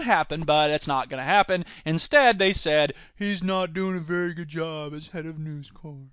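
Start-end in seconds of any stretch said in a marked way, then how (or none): 3.1-6.1 soft, lower, mock posh British accent. Mocking the committee's verdict, British accent. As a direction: read it like a stiff British MP. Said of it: "my flawless British accent".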